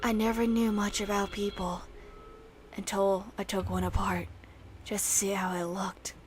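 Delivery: hushed, eerie reading of a killer's note; soft, ominous journal voice; creepy note read. Acted. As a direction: read it low and unsettling, like a confession.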